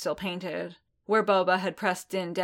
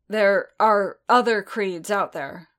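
louder, slow, pressing argument; arguing; 8 dB louder than usual. A narrator's reading. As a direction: push back hard on each word. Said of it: "Boba argued".

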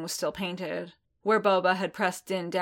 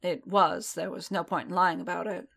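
usual speaking voice

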